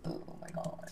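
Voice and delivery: breathless mock gasp. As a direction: gasp then whisper oh my god. Reaction to subscriber count.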